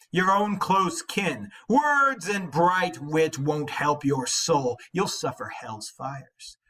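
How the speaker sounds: measured, deliberate reading of epic verse; dramatic reading of a translated epic; poem reading. Acted. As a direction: read it like an old saga, weighty and steady. Reading the Beowulf translation aloud.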